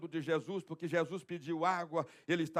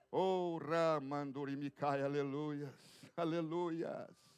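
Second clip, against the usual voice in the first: slow, soft ecstatic tongues; speaking in tongues; 4 semitones lower, 6 dB quieter, slower than usual. Real, not acted. Preacher praying softly in tongues, slow. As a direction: let it slip out softly, slowly in tongues.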